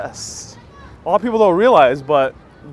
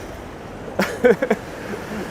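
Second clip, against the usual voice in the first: airy unvoiced laugh at herself; a quick silent chuckle; breathy laugh; 7 semitones higher than usual. Real, not acted. Laughing at own overpacking.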